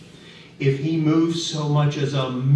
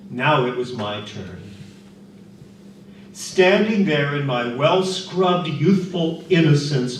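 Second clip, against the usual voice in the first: ordinary delivery, nothing marked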